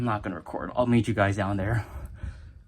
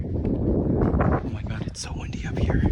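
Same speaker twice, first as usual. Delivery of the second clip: nervous whisper; slower than usual. Real, not acted. Nervous at the windy top.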